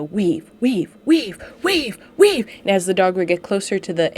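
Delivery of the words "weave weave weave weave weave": imitating a handler, building from plain to a high, loud yell; increasingly intense. Handler at a trial pressuring her dog in the weaves, building to a high, loud call. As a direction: start calm, then push each weave higher and louder. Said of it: "she'd make it more and more intense".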